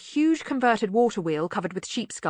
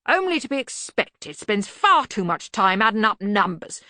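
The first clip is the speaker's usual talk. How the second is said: raised, hearty voice; blustering Arch-Chancellor; 3 semitones higher, 5 dB louder than usual. Acted. Arch-Chancellor discussing the bursar in his presence, blustering. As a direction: pronounce judgement with loud bluster. Ridcully character dialogue.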